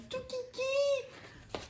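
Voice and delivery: very high, soft, drawn-out squeak; cutesy squeal. Acted. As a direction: coo it tiny and high, stretched out. Cooing over a cute little placemat.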